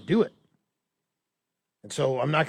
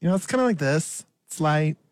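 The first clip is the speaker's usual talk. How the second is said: put-on Valley Girl voice. Shown as a demonstration. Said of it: "Valley Girl".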